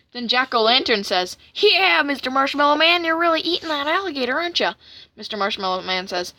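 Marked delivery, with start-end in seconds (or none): 1.5-4.7 high-pitched put-on character voice; wary cartoon sidekick. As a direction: question him in a high cartoon voice. Jack-o'-lantern character questions villain.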